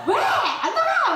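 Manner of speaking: high, mock-sulky yell; sulky child's refusal. Sulking daughter answering dad through door, petulant yell. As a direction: fling back a pouting, high refusal.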